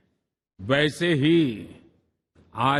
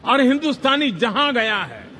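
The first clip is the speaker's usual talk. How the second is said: raised, louder, higher proclamation; emphatic orator; 8 semitones higher, 4 dB louder than usual. Real, not acted. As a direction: proclaim it to the crowd. Orator's rising emphatic phrase.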